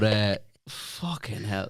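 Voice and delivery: usual speaking voice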